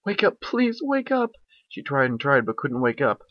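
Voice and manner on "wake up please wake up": high urgent plea to herself; high pleading cry; frantic call. Acted. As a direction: force yourself awake, panicking. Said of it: "Twilight called to herself".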